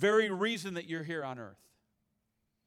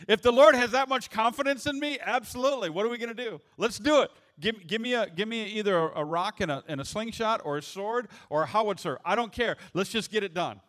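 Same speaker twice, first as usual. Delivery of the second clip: higher and louder, rallying for battle; fired-up preaching; 3 semitones higher, 5 dB louder than usual. Real, not acted. Preacher pumps up the congregation with eager, loud excitement. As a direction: charge ahead like a ready soldier. Preacher imagines God trusting him.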